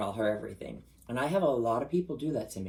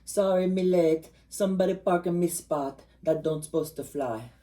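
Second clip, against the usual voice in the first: put-on Jamaican accent; 7 semitones higher, 6 dB louder than usual. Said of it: "[name] was on [name] and she goes".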